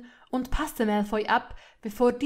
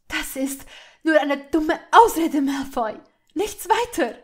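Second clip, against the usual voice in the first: loud, high, contemptuous dismissal; wrathful; 6 semitones higher, 10 dB louder than usual. A narrator's reading. Hermine breaking free from Malfoy's grip, wrathful. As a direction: throw his excuse back at him angrily. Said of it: "funkelte ihn weiterhin zornig an".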